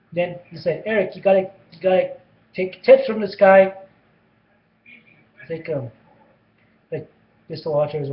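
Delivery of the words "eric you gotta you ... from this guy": louder and louder brash urging. Retold or imitated. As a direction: push Eric, building to a loud call. Mr Walters urging Eric loudly.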